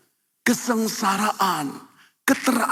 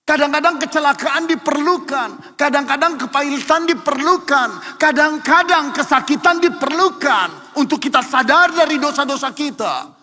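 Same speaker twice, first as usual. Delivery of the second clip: high, loud, driving repetition; fervent sermon climax; impassioned preaching; 6 semitones higher, 5 dB louder than usual. Acted. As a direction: hammer each line home to the congregation.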